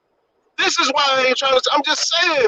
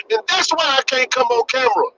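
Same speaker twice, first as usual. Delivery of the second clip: usual speaking voice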